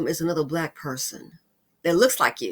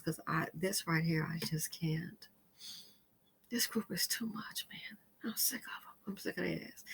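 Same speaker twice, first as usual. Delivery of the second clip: exhausted whispered rant; 4 semitones lower, 12 dB quieter than usual. Real, not acted. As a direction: whisper your disgust.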